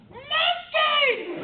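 high-pitched mock fan yell; high, drawn-out imitation squeal; retold excited shout. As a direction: call out like a fan from across a lobby. Fan spotting him across hotel lobby.

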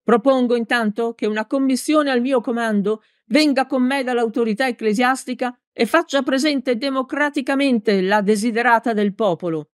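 high-pitched political oratory; balcony speech to the people; shouted speech. A narrator's reading. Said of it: "gridò Peppone".

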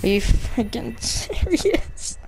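usual speaking voice

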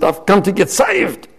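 high, eager retold announcement; eager declaration. Man coming forward at a crusade, eager declaration.

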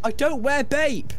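high, slow, offended protest; indignant denial. Real, not acted. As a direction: deny it, mock offended.

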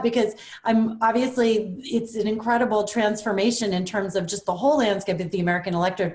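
normal talking voice

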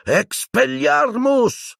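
high, drawn-out cry of a spell; shouted spell. A narrator's reading. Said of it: "gridò Lupin".

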